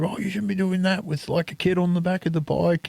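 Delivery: scolding ticket lady. Retold or imitated. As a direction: nag like a prim gate attendant. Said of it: "she had a go at me".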